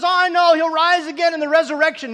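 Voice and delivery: high, loud mock know-it-all reply; imitating a too-quick believer; glib agreement. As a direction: rattle it off as if already known. Mimics Martha's glib answer, raised.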